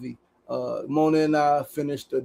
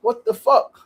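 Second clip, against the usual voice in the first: retold disbelief; 5 semitones higher, slower than usual. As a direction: relive the shock of the wiped laptop. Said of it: "I'm like".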